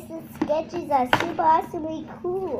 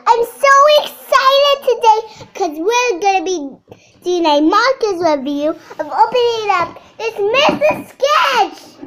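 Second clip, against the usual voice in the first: excited intro; 6 semitones higher, 8 dB louder than usual. Real, not acted. Excited kid opens her video.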